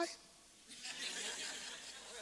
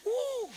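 high whoop. Real, not acted. Preacher's exclamation.

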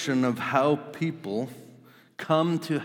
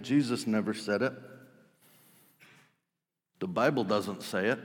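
ordinary talk, nothing marked

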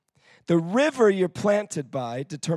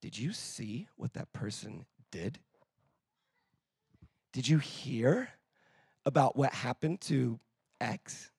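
much softer, lower, conspiratorial gossip; a churchgoer whispering gossip about someone; gossiping accuser; 3 semitones lower, 4 dB quieter than usual. Retold or imitated. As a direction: lean in like a gossip stirring trouble. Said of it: "you can tell from the tone of their voice".

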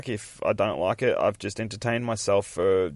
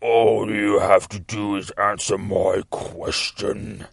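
rough, drawn-out demon voice; demonic voice; 4 dB louder, slower than usual. Retold or imitated. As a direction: slowly intone it like a menacing demon. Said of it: "like, a fucking demonic voice".

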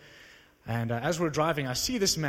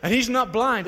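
louder, higher, baffled rant at the man; mock indignant shout, higher and louder; exasperated outburst; 5 semitones higher, 5 dB louder than usual. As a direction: let it burst out in disbelief. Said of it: "I wanted to say".

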